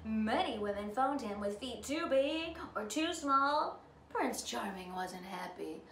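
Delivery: plain reading voice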